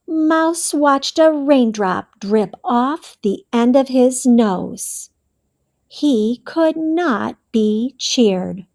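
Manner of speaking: usual speaking voice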